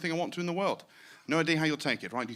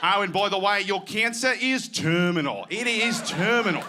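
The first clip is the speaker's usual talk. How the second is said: slow, louder breezy Aussie doctor; Australian accent; 4 semitones higher, 4 dB louder, slower than usual. Retold or imitated. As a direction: deliver bad news like a cheery Aussie bloke. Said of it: "what sounds better?".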